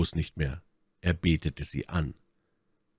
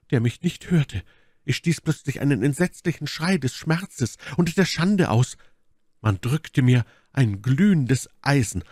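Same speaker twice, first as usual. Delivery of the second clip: hohe, dramatische Leidenserzählung; Milady, gequält; 9 semitones higher, 3 dB louder than usual. Acted. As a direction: die eigene Qual mit hoher Stimme eindringlich schildern. (gequälte, hohe Frauenstimme)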